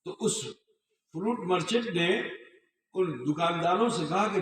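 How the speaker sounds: usual speaking voice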